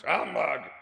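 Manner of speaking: put-on scornful old miser's snarl; grumpy Scrooge. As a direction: dismiss it with contempt. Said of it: "said Scrooge".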